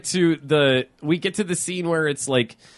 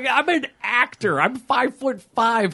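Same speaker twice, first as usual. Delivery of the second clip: higher-pitched, indignant excuse; mock protest; 6 semitones higher than usual. Frightened actor refusing to help the kid, mock indignant protest. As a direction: protest helplessly in a put-on complaint.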